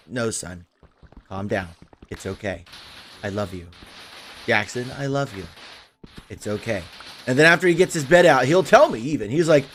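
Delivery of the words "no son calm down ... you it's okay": soft, hushed reassurance; gently calming a panicking child, much softer; soothing son. Retold or imitated. Dad hushing his upset son, soft and soothing. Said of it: "I'm just like".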